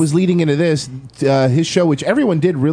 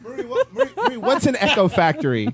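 laughing; 8 semitones higher, 4 dB quieter, faster than usual. Real, not acted. Laughing while asking.

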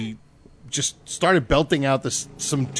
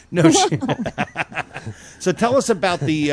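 talking through a laugh, higher pitched; 4 semitones higher than usual. Real, not acted. Host to guest about the fundraiser, laughing. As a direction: chuckle your way back to the question.

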